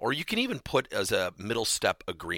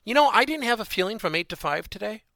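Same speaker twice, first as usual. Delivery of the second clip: lightly acted, higher-pitched mock reply; a higher pitch for an absurd answer; mock neutral answer; 10 semitones higher, 4 dB louder than usual. Spouse answering how the day was, higher mock-neutral reply. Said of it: "Nobody ever says".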